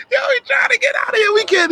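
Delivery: high, fast, crying shout; panicked shout. Real, not acted. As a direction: scream it while running off. Fleeing bugs, screaming.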